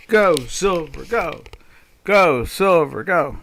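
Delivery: loud mock cheer for silver, chanted. Trader cheering silver on, loud mock chant. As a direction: cheer it on like a sports fan. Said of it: "we're always cheering for the silver".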